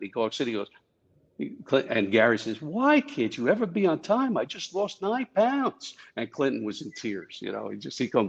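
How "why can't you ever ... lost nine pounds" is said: quoted prank line. Retold or imitated. Gary's prank line to Clinton.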